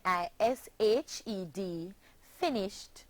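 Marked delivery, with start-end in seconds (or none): 2.4-3.0 "finished" in two syllables, closing on a t sound; two-syllable ending. Shown as a demonstration. Teacher models past tense for pupils, "finished" ending in t. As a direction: end "finished" on a voiceless t, adding no syllable. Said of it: "having two syllables".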